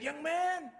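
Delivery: high, stretched call to a younger man; emphatic elder's address; older gentleman calling. Retold or imitated. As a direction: lift it up high and hold it. Older minister addresses him.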